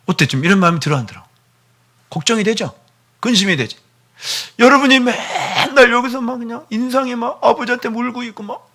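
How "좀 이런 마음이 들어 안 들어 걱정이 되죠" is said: ordinary talk, nothing marked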